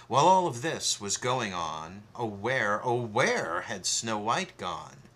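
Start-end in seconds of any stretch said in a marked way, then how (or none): none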